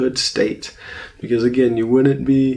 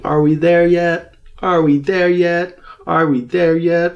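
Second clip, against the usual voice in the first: put-on high, louder child's nagging from the backseat; whiny-kid yell, pitched up and insistent; mock screaming child; 6 semitones higher, 4 dB louder than usual. Child in the backseat of a car, mock screaming.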